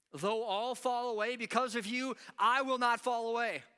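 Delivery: loud, high, insistent declaration; defiant vow. Acted. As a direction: proclaim it defiantly, refusing to back down. Peter's defiant vow in scripture.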